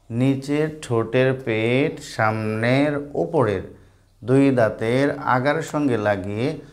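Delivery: slow, word-by-word dictation; slow teaching repeat. Shown as a demonstration. Teacher repeats the rule for a pupil, slow. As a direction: draw each word out for a learner.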